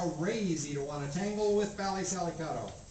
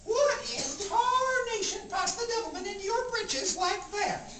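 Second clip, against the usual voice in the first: put-on much higher crackly old granny voice; old Grandma; 12 semitones higher than usual. Grandma scolding the little girl and grandpa, high old-woman voice. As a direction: play a feisty old grandmother, pitched way up. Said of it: "Grandma looks out at him and she says".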